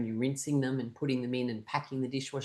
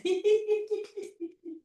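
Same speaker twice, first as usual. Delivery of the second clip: long high rattling laugh; sustained staccato giggles; motorbike laugh. Shown as a demonstration. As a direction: laugh like a revving engine. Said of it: "That's the motorbike laugh".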